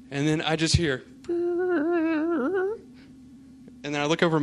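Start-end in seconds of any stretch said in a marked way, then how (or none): 1.3-2.8 long, much higher held squeak of a fart; imitated fart noise. Retelling dad's hospital fart as a high squeaky noise. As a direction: mimic the sound of dad passing gas. Dad suddenly passes gas.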